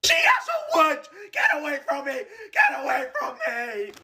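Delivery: high, strained yelling through the burn; pained shouting. Real, not acted. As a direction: scream out in pain. Screaming during spicy challenge.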